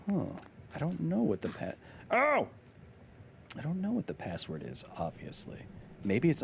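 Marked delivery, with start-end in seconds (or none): 2.1-2.5 high, sudden "oh"; startled cry. Real, not acted. Streamer interrupted while reading the password prompt, startled cry. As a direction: yelp that cuts the sentence off.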